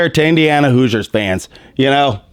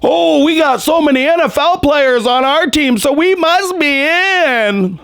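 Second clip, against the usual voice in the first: high, whiny mock brag; high mocking whine, slowing at the end; mocking elite fans; 9 semitones higher than usual. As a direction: sneer it like a spoiled team bragging. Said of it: "They want to say".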